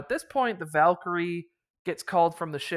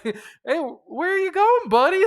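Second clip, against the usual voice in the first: high, loud, suspicious friendly challenge; questioning; 13 semitones higher, 8 dB louder than usual. Retold or imitated. Party calling after the fleeing priest: high suspicious query. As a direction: call after him with mock concern. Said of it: "just kinda questioning him".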